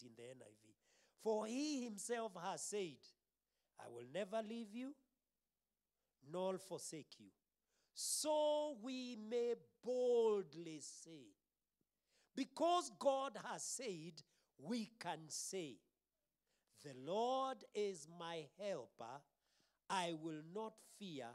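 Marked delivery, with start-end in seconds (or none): 1.2-7.3 raised, louder preacher's reading; emphatic scripture reading. Real, not acted. Reads scripture while preaching. 7.9-11.3 very slow, loud, high declaration; bold proclamation. Real, not acted. Rereads the verse boldly. 12.3-21.3 slow, forceful preacher's declaration; emphatic preaching. Real, not acted. Preaches the verse.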